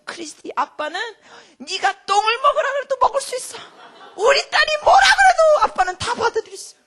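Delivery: very high, shouted dad impression; father's extravagant devotion. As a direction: build to a hammed-up declaration of fatherly love. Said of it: "표현이 조금 심하셨는데 이래요". (과장되게 외치는 아버지 흉내)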